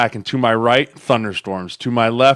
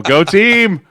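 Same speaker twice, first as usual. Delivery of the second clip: slow, louder, higher hammed-up cheer; mock cheer; 9 semitones higher, 7 dB louder than usual. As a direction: drag out a sarcastic pep cheer. Hopes pinned on young players.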